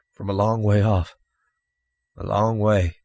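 lightly voiced weary man; a touch of far-off tiredness; weary stranger. Exhausted stranger answering children about his origin, mildly weary.

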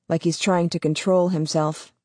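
ordinary delivery

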